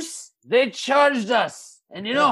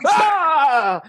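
short hammed-up yelp; pained knight cry; 5 semitones higher, 4 dB louder than usual. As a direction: cry out as if knocked flat. Knight knocked down.

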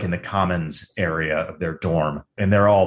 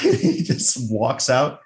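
ordinary talk

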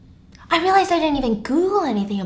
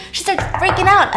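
ordinary delivery

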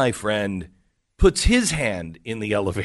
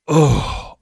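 imitated loud huff of annoyance; exasperated groan; 4 semitones higher, 5 dB louder than usual. Woman turning away from the friends, exasperated groan. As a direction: groan higher and louder, fed up. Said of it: "a few more grunts".

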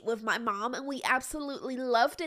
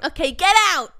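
loud command; 4 semitones higher, 13 dB louder than usual. Acted. Bartender throws customer out.

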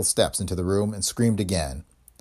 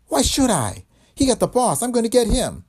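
high, loud defiant anger; 14 semitones higher, 4 dB louder than usual. Acted. Dame defiantly vowing to kill the man. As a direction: defy him hotly.

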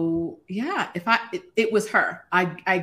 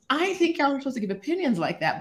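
usual speaking voice

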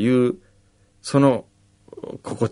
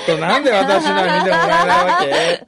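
pushy demanding woman; 7 semitones higher, faster than usual. Retold or imitated. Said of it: "怖いんですよ".

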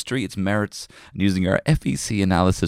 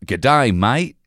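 higher, loud Australian greeting; Australian accent; 4 semitones higher, 6 dB louder than usual. Pretend. Welcoming podcast listeners, Australian accent. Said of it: "you can do the accent".

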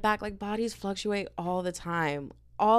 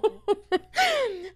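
laugh. Real, not acted. Laughing after the story.

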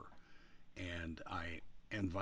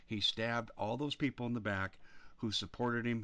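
ordinary talk, nothing marked

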